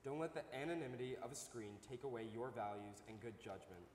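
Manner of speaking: normal talking voice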